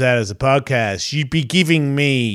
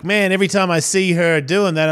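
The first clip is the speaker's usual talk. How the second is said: ordinary delivery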